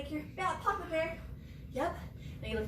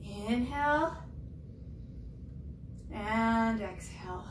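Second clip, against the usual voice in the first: gentle meditation voice; guided breathing; 5 dB quieter than usual. Acted. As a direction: guide the children into calm. Leads breathing cool-down.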